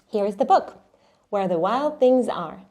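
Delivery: ordinary talk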